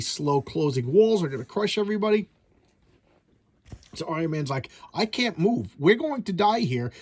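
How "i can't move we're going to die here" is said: higher-pitched, lightly acted alarm; mild mock panic. Iron Man tells the girl they're doomed, mild mock panic. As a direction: play a trapped hero, half-heartedly panicking. Trapped hero panics about dying.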